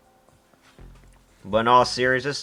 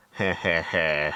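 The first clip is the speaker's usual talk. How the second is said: slow, hammy anime villain chuckle; a cheesy evil heh heh heh; cheesy villain laugh; 3 semitones lower, slower than usual. Acted. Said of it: "The cheesy anime villain laugh".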